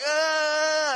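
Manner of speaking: high, strained gasp for air; hammed-up winded cry; mock winded gasp. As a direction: wheeze like the breath was knocked out. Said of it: "the two of us are like".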